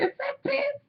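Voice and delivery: high, hushed, strangled disbelief; very high thin gasp of a question; shocked squeak. Retold or imitated. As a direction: squeak it out in horror. Retold own reaction to smell.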